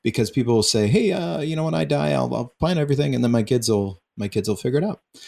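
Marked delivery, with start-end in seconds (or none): 0.9-5.0 higher-pitched offhand voice imitating carefree parents; imitated casual brush-off. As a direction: mimic someone breezily waving the whole matter away. Quoting people who brush off planning.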